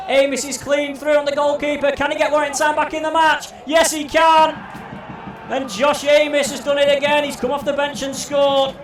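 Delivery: rising shouted commentary; excited goal call. Real, not acted. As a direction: yell it as the goal goes in.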